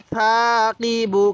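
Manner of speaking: সাকিবু slowly drawn out on the long vowels; slow, sustained teaching take of সাকিবু; model recitation. Shown as a demonstration. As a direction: stretch সা and কি in সাকিবু. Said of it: "কীভাবে শিখব?".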